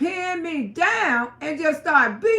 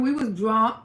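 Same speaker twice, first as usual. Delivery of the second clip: usual speaking voice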